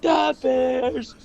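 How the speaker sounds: imitating an excited, high-pitched cry; retold excitement. Fan waking up to the Mac trade news, retold high-pitched excitement. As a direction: re-enact waking up to huge team news, voice way up high.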